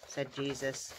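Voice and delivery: ordinary delivery, nothing marked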